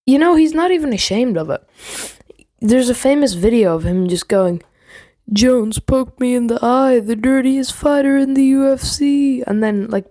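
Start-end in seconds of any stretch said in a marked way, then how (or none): 5.3-9.4 higher-pitched mock whine of complaint; mock crying. Imitating Jones's famous video complaint, mock crying. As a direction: imitate a fighter pretending to cry. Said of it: "pretending to cry".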